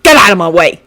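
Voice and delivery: loud furious snap; angry hiss. Acted. Bakugo snapping at Shinso blocking his path. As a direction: spit it through bared teeth. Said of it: "Bakugo hissed".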